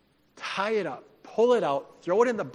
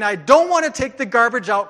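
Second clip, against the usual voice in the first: loud, high-pitched complaint; mock whining; 4 semitones higher, 8 dB louder than usual. Preacher imitating church members who won't do chores, mock whining. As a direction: protest like a whiner refusing a chore. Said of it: "whine and complain".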